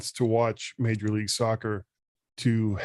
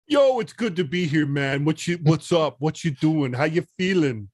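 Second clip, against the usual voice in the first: bad New York beach accent; 4 semitones higher than usual. Guest greets the podcast hosts, bad New York beach accent. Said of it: "New York beach accent".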